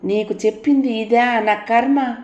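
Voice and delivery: wife bewailing her fate to her brother, slowly; despairing lament. A narrator's reading. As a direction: moan it as if beating her head. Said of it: "అంటూ నెత్తి బాదుకుంది".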